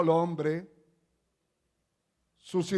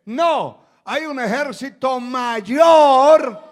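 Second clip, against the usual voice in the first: loud, rising preacher's cry; full-force preaching; 4 semitones higher, 10 dB louder, slower than usual. Acted. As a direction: proclaim it to the whole congregation, drawing out key words.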